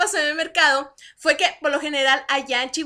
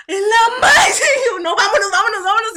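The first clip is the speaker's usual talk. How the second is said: loud, high laughing dismay; laughing alarm; 6 semitones higher, 8 dB louder than usual. Retold or imitated. Caught with a flyer, urging herself to leave, loud and laughing. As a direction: hurry off in comic panic.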